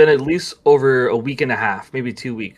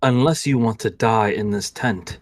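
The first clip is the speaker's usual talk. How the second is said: ordinary delivery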